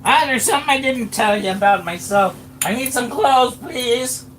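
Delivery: put-on high squeaky old man voice. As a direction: pipe up sheepishly in a squeak. GM voices Daryl in character.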